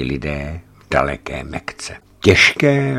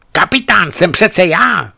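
high, loud feverish outburst; cry out; 13 semitones higher, 7 dB louder than usual. A narrator's reading. Feverish woman crying out to the men, high and loud. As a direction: shout it, delirious and proud. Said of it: "vykřikla".

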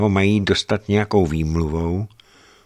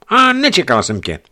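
wife voiced; 11 semitones higher, 6 dB louder than usual. Greg voices his wife's words.

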